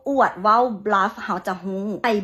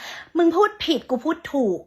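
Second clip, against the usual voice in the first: higher, smug taunting voice; mock clerk taunt; 6 semitones higher than usual. Clerk bullying the customer, smug higher taunt. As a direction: taunt like a know-it-all. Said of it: "อยากบูลลี่ว่า".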